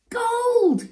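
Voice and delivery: loud, high shout of discovery; excited cry. A narrator's reading. Boy spots spilled gold on the road, loud high cry. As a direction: cry out at an astonishing find. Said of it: "he cried".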